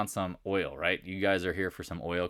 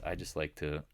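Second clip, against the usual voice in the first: usual speaking voice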